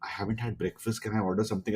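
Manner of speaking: a hint of a hungry child begging; pleading daughter. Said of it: "she's like".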